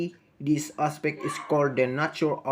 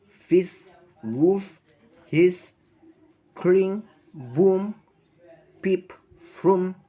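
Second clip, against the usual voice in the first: each example word said higher and louder; bright emphatic hits on the onomatopoeia; punchy sound-word examples; 5 semitones higher, 5 dB louder, much slower than usual. Shown as a demonstration. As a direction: pop every sound word out like the noise it names.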